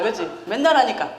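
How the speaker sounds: ordinary delivery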